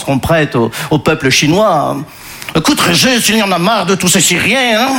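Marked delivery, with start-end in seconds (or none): 2.6-4.9 hammed-up Belgian French accent. Shopkeeper confiding in Régis about Syrians, comic Belgian accent. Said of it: "je prends l'accent belge".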